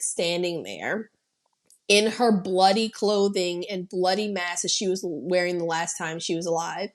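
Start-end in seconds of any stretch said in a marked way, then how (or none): none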